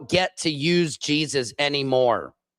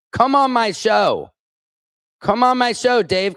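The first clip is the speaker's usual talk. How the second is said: raised, combative dare; real, heated challenge; angry challenge; 5 semitones higher than usual. Real, not acted. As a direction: call them out, pitch jumping up. Daring online commenters to face them.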